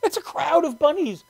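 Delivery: high, loud squeals of delight; overjoyed. Acted. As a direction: lose it with childlike excitement, then ease down. Acts out delight at bunnies.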